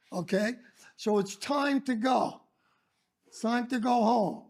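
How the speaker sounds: ordinary delivery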